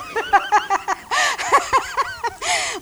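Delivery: high amused laugh; real laugh. Real, not acted. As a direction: break up laughing at the bit. Laughing at his own joke.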